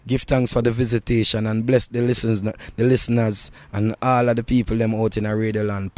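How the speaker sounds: ordinary talk, nothing marked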